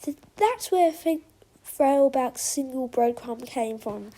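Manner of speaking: usual speaking voice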